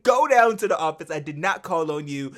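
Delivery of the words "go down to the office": higher, louder mock-stern command; fed-up order. Teacher ordering the student out, fed-up and loud. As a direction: send him off, imitating. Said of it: "Sister James was tired of that shit".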